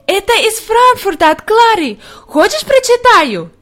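put-on very high, squealed childlike delight; high eager outburst; excited little girl. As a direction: announce the letter in thrilled child's voice. Heidi excited by Clara's letter.

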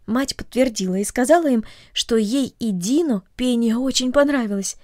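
usual speaking voice

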